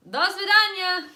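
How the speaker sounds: panicked Russian farewell shouted at strangers; panicked goodbye. Retold or imitated. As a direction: panic and blurt the only Russian you know. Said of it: "I just panicked".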